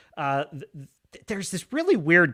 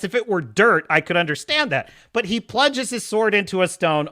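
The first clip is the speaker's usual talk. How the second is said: normal talking voice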